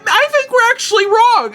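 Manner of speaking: backpedaling mom. Retold or imitated. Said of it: "she's like".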